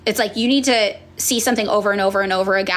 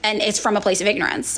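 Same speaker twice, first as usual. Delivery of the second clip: ordinary delivery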